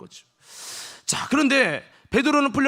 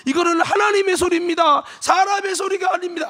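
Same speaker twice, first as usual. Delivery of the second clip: fawning acclaim; 8 semitones higher, 5 dB louder than usual. Acted. Crowd flattering Herod.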